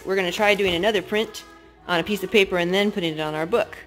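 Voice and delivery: ordinary talk, nothing marked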